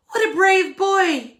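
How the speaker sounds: high, loud, slow joyful cry; proud mother. Acted. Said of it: "his mother cried".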